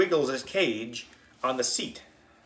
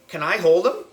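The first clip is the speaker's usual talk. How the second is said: eager sister; 7 semitones higher, 6 dB louder than usual. A narrator's reading. Sister asks to hold ferret.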